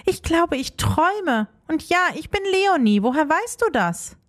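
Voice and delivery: high-pitched, mild disbelief; a touch of bewilderment, voice lifted; stunned. A narrator's reading. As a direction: speak as if you can't quite believe it. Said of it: "Leonie war fassungslos".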